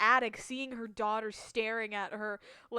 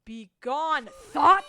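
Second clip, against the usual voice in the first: loud shouted command; yelled banish; 5 semitones higher, 14 dB louder, much slower than usual. Acted. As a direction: yell it like banishing a spirit. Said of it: "I keep yelling "Begone, Thought".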